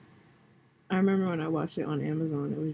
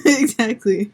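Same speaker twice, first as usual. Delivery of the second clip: laughing; 4 semitones higher, 7 dB louder, slower than usual. Real, not acted. Laughing agreement with friend.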